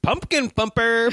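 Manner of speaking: high, slow, hammed-up creep; mock creep voice. Mimicking the creep's first message, high and slow. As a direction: drawl it out high like a proud creep.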